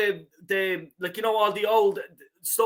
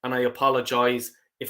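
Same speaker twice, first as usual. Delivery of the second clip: ordinary talk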